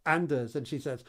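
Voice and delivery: ordinary talk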